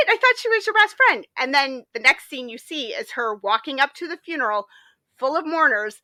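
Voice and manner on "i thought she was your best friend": high, loud disbelief; baffled protest; shocked. Retold or imitated. Girl stunned by the old woman, in disbelief. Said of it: "the girl's like".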